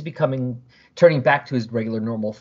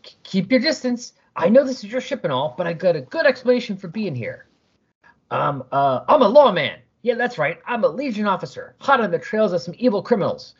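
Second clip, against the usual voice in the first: higher, stammering lawman bluff; nervous bluff; 7 semitones higher than usual. Acted. Smee bluffing to aliens that he is a lawman, nervous. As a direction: talk fast to cover the fear.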